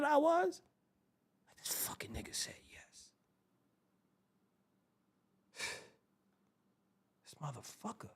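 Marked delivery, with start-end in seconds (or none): 1.6-3.1 whispered, no voice at all; disbelieving whisper. Acted. Stunned that his friend said yes, whispering. 7.2-8.1 very soft, under the breath; muttered disbelief. Acted. Still stunned at his friend, muttering softly.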